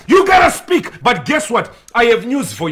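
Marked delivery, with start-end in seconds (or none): 0.1-1.0 high, loud exhortation; urging the church to speak up, shouting; preaching shout. Real, not acted. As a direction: cry it out to the congregation. Preacher exhorts congregation again.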